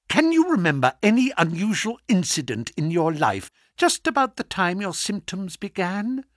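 ordinary delivery

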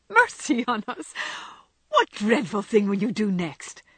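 high, loud exclamation easing down; aghast. Acted. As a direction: cry out scandalised at the news. Jo shocked by the news.

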